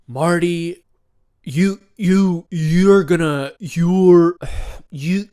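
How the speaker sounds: loud, halting attempt at a macho voice; a strained stab at an alpha male voice; failed alpha male voice. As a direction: try to sound tough and keep stumbling. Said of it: "put on his alpha male voice".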